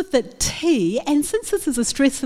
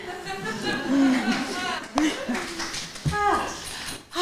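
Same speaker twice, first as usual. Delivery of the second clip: soft laugh; 5 semitones higher, 6 dB quieter than usual. Real, not acted. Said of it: "Ah, repetitive again".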